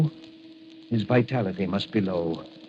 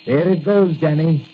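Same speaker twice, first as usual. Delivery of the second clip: excited send-off; 5 semitones higher, 7 dB louder than usual. Acted. Doctor sends energy to Danny.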